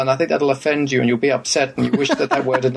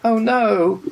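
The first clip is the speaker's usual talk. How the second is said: mock panic; 11 semitones higher than usual.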